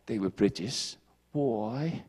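low, soft mock British accent; a hint of a posh British Pharisee. Preacher voicing Pharisees in a soft mock British accent. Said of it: "speak in a British accent".

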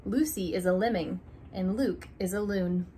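ordinary delivery

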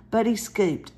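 ordinary delivery, nothing marked

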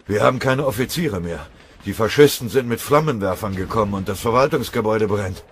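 loud, high breathless alarm; desperate messenger. Acted. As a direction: gasp out the disaster. Verwundeter Melder meldet Katastrophe.

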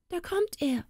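high, thin alarm without volume; high-pitched mock cry of fright; frightened cry. A narrator's reading. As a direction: cry out in alarm, kept small. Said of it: "schrie die Frau".

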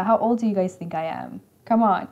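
usual speaking voice

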